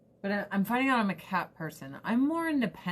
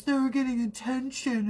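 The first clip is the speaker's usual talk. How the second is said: jealous dog voice; 5 semitones higher than usual. Pretend. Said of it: "the dog's so jealous".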